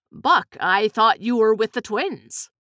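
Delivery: ordinary delivery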